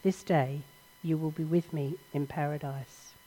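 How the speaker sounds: a tender, soft promise; gentle reply. Acted. Jesus answering the dying thief, soft and gentle. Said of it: "A gentle smile crosses his face".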